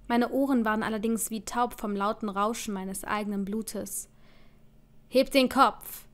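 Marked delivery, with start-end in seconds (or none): 5.1-6.0 loud, harsh order; shouted command. A narrator's reading. Captor ordering his beaten prisoner, shouting. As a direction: bellow it at the prisoner. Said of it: "herrschte Fred mich laut schreiend an".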